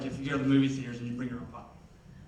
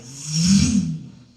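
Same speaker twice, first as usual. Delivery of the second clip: loud imitated rev rising then falling; truck engine roar; 3 semitones higher, 11 dB louder than usual. Comic telling of a truck on the highway, loud engine noise. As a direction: make the noise of a big truck roaring past.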